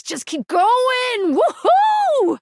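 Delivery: high, drawn-out cheer into a whoop; whooping encouragement, stretched and high; excited cheering. Acted. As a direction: cheer them on, rising to a big woohoo.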